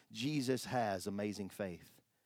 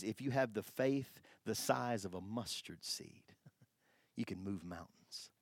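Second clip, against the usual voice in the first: whispered quote; 4 semitones lower, 7 dB quieter than usual. Acted. Quoting Jesus in a whisper.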